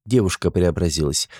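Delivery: ordinary delivery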